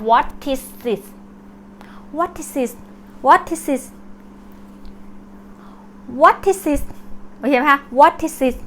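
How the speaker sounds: "What is it" drilled as what-tis-sit; linked words. Shown as a demonstration. Teacher drilling the blended question for learners, linked. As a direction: run "What is it" together, final sounds carried over. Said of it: "เวลาเขาออกเสียงควบกัน".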